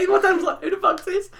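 high, loud, words squeezed out through laughter; can barely get it out for laughing. Real, not acted. Retelling dad's phone call, laughing hard.

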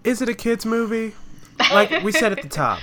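high-pitched, loud incredulous query; skeptical challenge. Real, not acted. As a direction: question it with pointed disbelief. Challenges the friend's claim.

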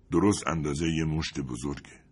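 usual speaking voice

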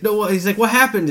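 high, confused, alarmed quote; mock bewilderment. Boy rejected by the wizard, high mock bewilderment. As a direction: ask it high and thrown. Acting out confused boy's panic.

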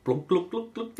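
soft, high glunk noises; imitated glugging spill. Imitating chemicals spilling in the cupboard, playful sound effect. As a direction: mimic liquid plopping out in little bursts. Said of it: "you hear clang".

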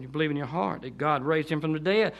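ordinary talk, nothing marked

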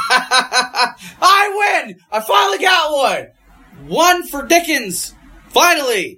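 loud, high laughing victory cry; triumphant shout. Real, not acted. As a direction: cheer your own win. Celebrating a won joke.

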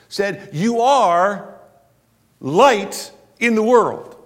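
loud rising sermon delivery; full-force preaching. Acted. Pastor stirs the church about their identity, preaching loudly. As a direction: preach it out to the back pews.

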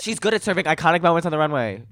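loud, high and excited. Real, not acted. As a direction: belt it out with excitement. Excited outburst about runway.

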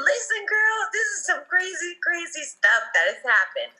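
exclaiming to a friend in disbelief; emphatic outcry. Real, not acted. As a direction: cry it out in disbelief. Emphatic outcry telling story.

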